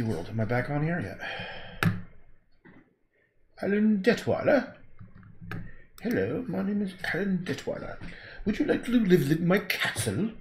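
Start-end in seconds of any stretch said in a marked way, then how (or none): 3.6-4.7 slow, higher mock posh British; upper class British accent. Painter imitating his old patron, upper class British accent. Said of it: "very upper class British accent". 6.0-10.3 higher mock posh British introduction; hammed-up upper class British host; upper class British accent. Painter impersonating the eccentric patron, upper class British accent. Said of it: "very upper class British accent".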